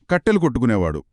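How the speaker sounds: usual speaking voice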